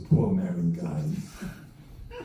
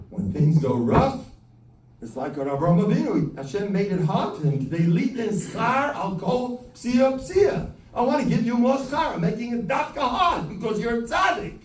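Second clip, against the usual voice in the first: fervent self-encouragement, louder and higher; preaching shout; 9 semitones higher, 4 dB louder than usual. Retold or imitated. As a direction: proclaim it like a sermon peak. His own upbeat self-talk.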